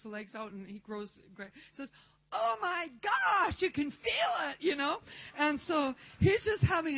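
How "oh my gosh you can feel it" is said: high, loud, drawn-out astonishment; amazed outcry. Retold or imitated. Hairdresser feels his leg grow, crying out amazed. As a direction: cry out in stunned wonder, stretching the words.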